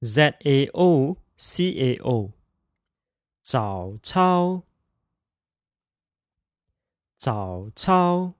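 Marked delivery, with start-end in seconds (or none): 3.4-8.3 早操 with third tone then first tone; practicing tones. Shown as a demonstration. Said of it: "third tone, first tone pair".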